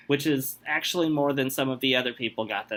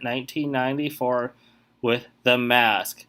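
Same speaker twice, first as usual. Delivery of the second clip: ordinary delivery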